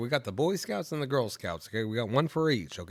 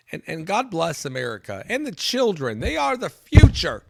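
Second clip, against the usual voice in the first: high, shouted patriotic finish; mock-rousing speech climbing to a yell; grandiose sign-off; 8 semitones higher, 12 dB louder than usual. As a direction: build to a ringing, over-the-top shout.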